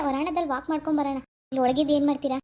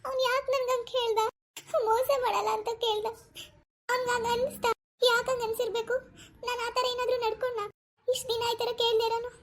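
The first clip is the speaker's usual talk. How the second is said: higher, hushed, wounded self-questioning; hurt and tearful; 8 semitones higher than usual. Acted. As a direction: ask yourself why, close to tears. Pavi alone, hurt by question.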